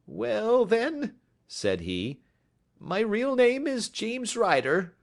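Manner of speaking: high, anxious little man's voice; a jittery clerk trying to sound eager; high-pitched nervous visitor. A narrator's reading. Ryder confesses name.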